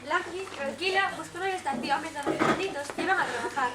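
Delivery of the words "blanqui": ordinary delivery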